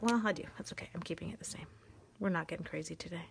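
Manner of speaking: hushed quick whisper. Real, not acted. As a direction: whisper it to yourself. Whispered aside while coloring.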